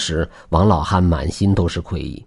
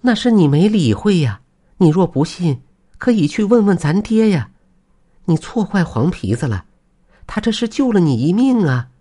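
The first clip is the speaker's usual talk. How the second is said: high-pitched chiding wife voice; high female character, reproving; 11 semitones higher than usual. Acted. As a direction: chide from high up in the voice. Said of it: "妻子说道".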